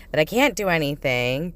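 plain reading voice

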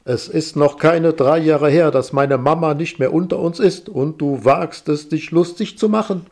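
loud indignant huff; snorting angrily. Acted. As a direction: bristle and snap at her. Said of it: "schnaubt er".

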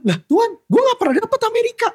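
high-pitched mock protest to God.

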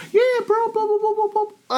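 squeaky blah-blah parody; high mock babble. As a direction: parody a liar's rambling. Mocking a liar's story.

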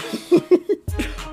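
high, airy giggle. Real, not acted. Host amused by own squeal, giggling. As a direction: giggle right after the squeal.

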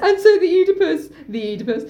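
ordinary delivery

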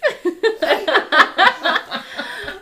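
high, loud cackle; helpless high-pitched giggling; burst of laughter. Real, not acted. Amused by child in costume.

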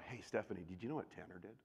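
soft, low gossip murmur; hushed gossip behind a back; gossipy hush. Retold or imitated. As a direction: lean in and dish the gossip quietly. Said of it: "I'm talking about".

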